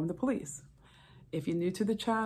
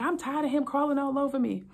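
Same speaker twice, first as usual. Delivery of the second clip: fed-up wife; 8 semitones higher, 4 dB louder than usual. Retold or imitated. Wife complaining in argument.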